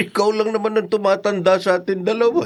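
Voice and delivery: laughing through a teasing jab, quick; teasing an old friend, laughing as he talks; laughing tease. Acted. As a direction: chuckle while mocking a friend's age. Teasing friend about getting old.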